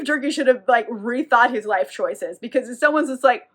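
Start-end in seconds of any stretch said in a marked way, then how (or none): none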